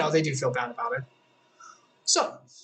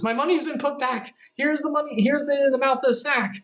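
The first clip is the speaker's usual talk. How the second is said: alarmed brother; 8 semitones higher than usual. Acted. Brother finds money in sack.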